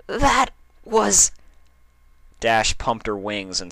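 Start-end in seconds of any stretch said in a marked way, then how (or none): 0.1-1.3 awestruck. Acted. Dash awestruck, lost for words.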